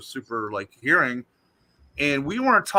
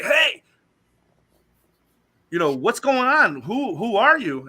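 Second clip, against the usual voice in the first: high, louder challenge to a stranger; retold confrontation; 8 semitones higher, 4 dB louder than usual. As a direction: call out sharply into the dark. Retold confronting intruder, shouting.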